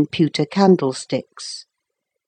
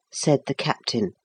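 plain reading voice

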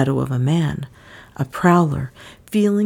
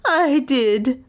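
high plaintive wail; 8 semitones higher than usual. A narrator's reading. Frightened maid owning up to screaming, wailing. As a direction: wail it mournfully. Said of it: "Lizzie wailed".

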